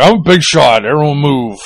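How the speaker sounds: mock big shot. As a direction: push it out like a pompous big shot. Mocking a self-important fellow journalist.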